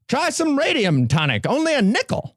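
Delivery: high, hammed-up sideshow pitch; carnival barker voice. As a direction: hawk it like a fairground barker. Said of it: "Carnival Barker voice".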